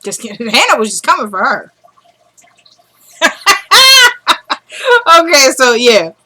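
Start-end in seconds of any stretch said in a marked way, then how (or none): 3.2-5.1 high-pitched burst of laughter. Real, not acted. Recapper tickled by her own remark, high laugh. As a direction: crack up high at your own joke.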